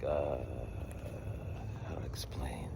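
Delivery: very low, soft, halting murmur; lost for words. Retold or imitated. Stuck for an answer to the caseworker, quiet murmur. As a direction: think aloud quietly, at a loss.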